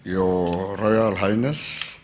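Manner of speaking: ordinary delivery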